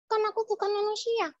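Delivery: put-on very high childlike doll voice; puppet child. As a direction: protest as a little talking puppet. (suara boneka anak tinggi)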